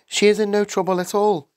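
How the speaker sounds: earnest, high-voiced schoolteacher; insistent teacher. A narrator's reading. Teacher contradicts the father.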